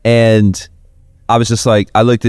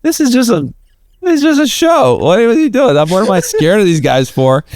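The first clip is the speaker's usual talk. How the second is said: higher, put-on voice of a sudden realization; inner realization; 8 semitones higher than usual. Narrator voicing his own thought backstage, higher and amused. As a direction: let the fear drain out into disbelief. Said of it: "I went".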